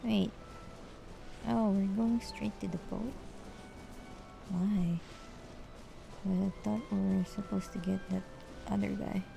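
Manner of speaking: normal talking voice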